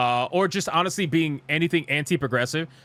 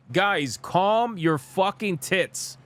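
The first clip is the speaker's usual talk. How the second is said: slow emphatic; 2 semitones higher, much slower than usual. Real, not acted. Streamer telling the angry fanbase to chill, slow and emphatic. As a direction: drag out calm your fucking tits word by word.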